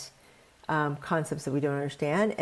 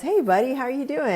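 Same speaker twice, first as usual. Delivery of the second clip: higher, friendly pond frog greeting; 9 semitones higher, much faster than usual. Retold or imitated. Narrator playing the pond frog greeting a stranger, higher and friendly. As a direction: lightly voice a cheery little frog. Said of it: "And the pond frog says".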